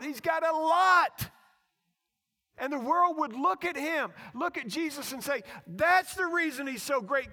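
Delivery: loud, high, driving sermon delivery; full-force preaching. Real, not acted. Preacher contrasting great crowd with ignored beggar, full-force preaching. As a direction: preach it hard to the whole crowd.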